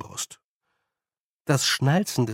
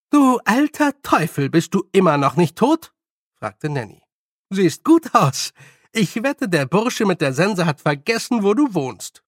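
higher, lively teasing greeting; cheeky old witch, teasing; 5 semitones higher, 4 dB louder than usual. Acted. Nanny greets old man who shot at them, teasing. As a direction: rib an old friend in a high, lively voice.

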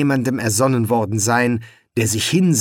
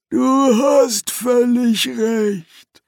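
dejected; 11 semitones higher, much slower than usual. Acted. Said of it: "erwiderte der Dämon niedergeschlagen".

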